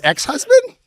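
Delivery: spring it up as a punchline; high mock question. Real, not acted. Contrasting himself as ex-husband, a high rising ask.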